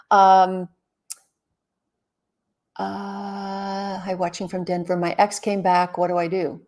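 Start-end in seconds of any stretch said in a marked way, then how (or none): none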